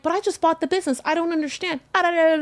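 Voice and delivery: high, bewildered protest; plead, lost and confused; confused plea. Retold or imitated. To the seller taking everything, a high confused plea. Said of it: "And I'm like".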